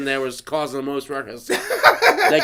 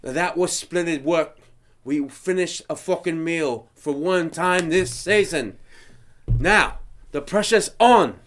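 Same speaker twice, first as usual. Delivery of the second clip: slow, measured mock pronouncement; deliberate host-like chef, building in force; imitated chef announcement; 3 semitones higher, slower than usual. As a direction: announce elimination with drawn-out drama. Imitating chef's solemn announcement.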